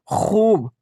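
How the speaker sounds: खूब with the oo stretched slowly; drawn-out vowel. Shown as a demonstration. Teacher gives students another example, drawn-out खूब. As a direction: hold the long oo in खूब. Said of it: "खींच कर पढ़ा जा रहा है".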